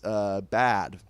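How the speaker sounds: ordinary delivery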